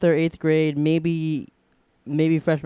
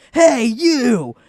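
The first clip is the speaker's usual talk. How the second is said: louder and higher, calling across a block; yelling hey you; 7 semitones higher, 6 dB louder than usual. Retold or imitated. Biker calling to a boy from a block away, shouting. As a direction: shout it down the street at a kid.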